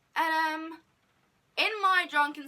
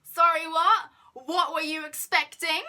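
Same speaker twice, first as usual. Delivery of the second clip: higher, louder, retelling her disbelief; re-live the baffled double take; incredulous; 5 semitones higher, 7 dB louder than usual. Retold or imitated. Reacting to friend's odd remark.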